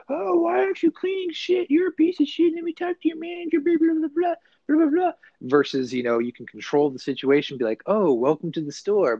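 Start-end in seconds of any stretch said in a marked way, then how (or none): 0.1-5.1 shrill-pitched, hammed-up complainer, very high; angry customer rant. Customer berating store staff over poor service, hammed-up high-pitched outrage. 7.9-9.2 lightly acted store greeter, pitch lifted; mock welcoming greeting. Clerk welcoming a guest to the store, lightly acted friendly greeting.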